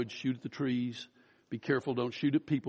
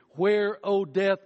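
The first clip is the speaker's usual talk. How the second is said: slow, lifted, louder Where, O death; rhetorical cry; 6 semitones higher, 4 dB louder, slower than usual. Acted. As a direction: call out the challenge to death.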